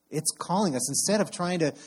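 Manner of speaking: usual speaking voice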